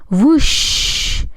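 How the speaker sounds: high breathy rush of air; big whooshing gust. A narrator's reading. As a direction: blow with every ounce of strength. Wolf blows at brick house.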